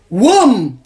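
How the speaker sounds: loud, booming "wham" for a slap; slap sound. Retold or imitated. Storyteller imitating her ex's hot slap, booming. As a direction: punch out the noise of a blow.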